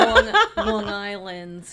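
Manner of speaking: nasal Long Island accent, drawn out. Shown as a demonstration. Mocking the Long Island accent, drawn-out Long Island vowels. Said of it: "the Long Island one's just very".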